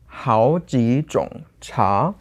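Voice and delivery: word-by-word 好几种茶, 茶 rising; second-second-third-second tones. Shown as a demonstration. Student says "several types of tea", tone by tone. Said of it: "So it's second, second, third".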